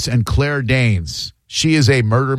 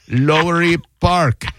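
imitated caller; 4 semitones higher, slower than usual. Retelling caller reading a Googled place.

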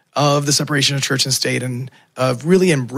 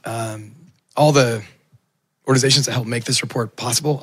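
ordinary talk, nothing marked

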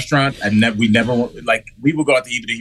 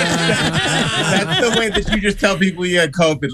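high voice breaking up with laughter; laughing; 8 semitones higher than usual. Real, not acted. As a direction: talk through a real laughing fit. Cracking up at covid remark.

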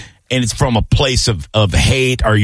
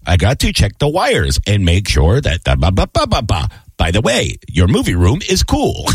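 translator app voice; 2 semitones lower than usual. Retold or imitated. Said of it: "talking to me through, uh, uh, one of those mobile translator apps".

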